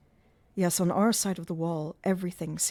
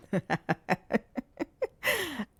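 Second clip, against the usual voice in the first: higher sinister chuckle; nasty chuckle; 7 semitones higher than usual. A narrator's reading. Eric mocking Christine's lie, nasty chuckle. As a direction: chuckle nastily. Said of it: "Another nasty chuckle".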